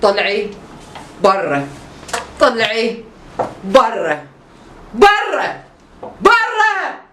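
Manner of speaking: building from firm orders to a high furious yell; angry yelling. Acted. Doctor losing patience, yelling at patient to get out.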